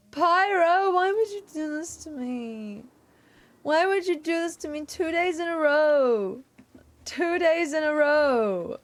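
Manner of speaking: high-pitched playful wail; drawn-out hammed-up lament; mock anguish. Mock lament to raider Pyro.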